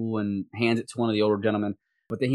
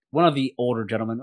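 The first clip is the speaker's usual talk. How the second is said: normal talking voice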